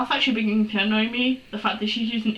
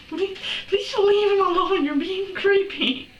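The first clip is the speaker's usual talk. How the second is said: high, pleading outburst; exasperated pleading; 8 semitones higher than usual. Acted. Reader begging James to back off, high exasperated plea. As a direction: beg in a high voice, drawing out the last words. Reader begs the fanfiction character.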